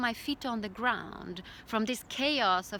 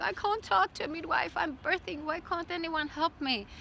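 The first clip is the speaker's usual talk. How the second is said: high, desperate cry for help; desperate plea; 7 semitones higher than usual. Retold or imitated. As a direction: plead high as if in pain. Desperate during contraction.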